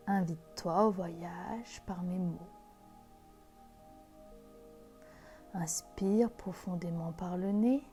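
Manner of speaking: slow, soft meditation voice; guided meditation. Acted. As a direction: guide them into calm, gently. Leads a guided visualisation.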